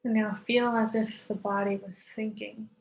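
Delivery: calm yoga nidra voice; guided meditation. Acted. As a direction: ease listeners into stillness, unhurried. Leads guided relaxation with long pauses.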